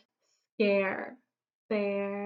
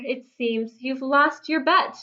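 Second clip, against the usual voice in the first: ordinary delivery, nothing marked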